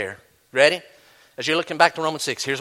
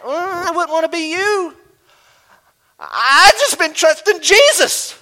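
confused believer; 11 semitones higher, 5 dB louder, slower than usual. Retold or imitated. Preacher voices a confused believer.

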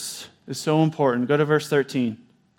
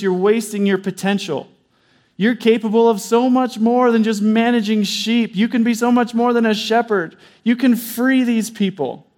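preacher rousing the church to reach for more; fervent preaching; 5 semitones higher than usual. Acted. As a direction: build the sermon to its peak. Preaching at full force.

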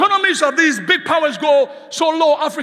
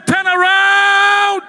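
drawn-out shout; 4 semitones higher, 4 dB louder, much slower than usual. Real, not acted. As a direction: turn around, shouted slow and loud. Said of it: "Somebody say".

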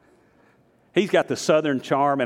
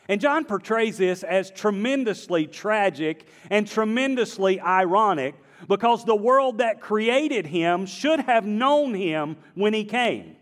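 usual speaking voice

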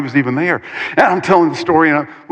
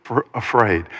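ordinary talk, nothing marked

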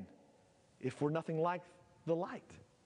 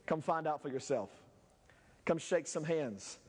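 normal talking voice

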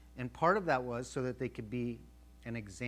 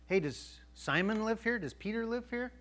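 higher, like strangers calling out at a door; calling at the gate; 8 semitones higher than usual. Acted. Cornelius's men asking for Peter at the gate, calling out high. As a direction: call up to the house, pitched high.

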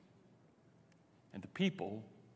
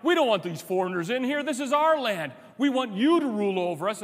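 raised, higher and louder, a fired-up crowd chanting; rallying crowd shout; 8 semitones higher, 7 dB louder than usual. Pretend. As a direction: shout it like the mob rallying behind him. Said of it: "And you're like".

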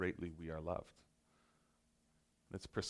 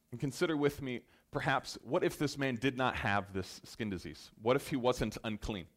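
normal talking voice

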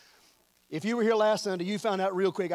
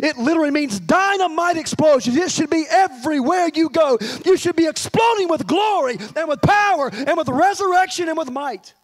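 high, building proclamation; impassioned preacher at full force; fervent preaching; 6 semitones higher, 6 dB louder than usual. Real, not acted. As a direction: drive it home forcefully to the congregation.

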